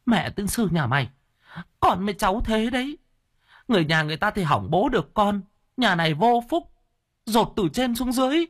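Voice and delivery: high-pitched, aggrieved cursing; bitter mother's high wail; choked indignation. Acted. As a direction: curse after your ungrateful son. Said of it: "Bà Thoa trông theo mà uất nghẹn".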